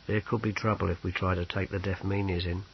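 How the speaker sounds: low, hushed confidential aside; whispering. A narrator's reading. Sergeant warns Snibrill of possible trouble, hushed. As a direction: keep it soft and low, lightly hushed. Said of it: "Carius whispered to Snibrill".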